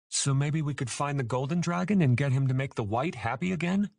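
bursting with a hopeful idea; excited. A narrator's reading. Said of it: "Cam asked excitedly".

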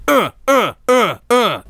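short rhythmic grunts, hammed up; mock porno grunts. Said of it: "yellow M&M just".

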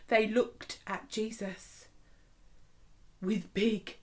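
plain reading voice

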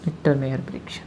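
low quiet restatement; teacher quietly writing the Hindi name. Shown as a demonstration. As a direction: say it again softly while writing. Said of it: "परीक्षण कहते हैं उन्हें".

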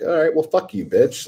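mock-angry retort, high at the start; retold annoyance breaking into a laugh; pissed off. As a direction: play pissed off, half laughing by the end. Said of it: "And I'm all pissed off".

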